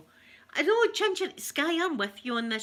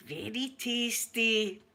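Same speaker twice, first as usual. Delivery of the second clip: slow, deadpan mock politeness; imitating the guest; much slower than usual. Mimicking the coworker's polite lie, slow and deadpan. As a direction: drag it out, unconvincing. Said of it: "he was like that".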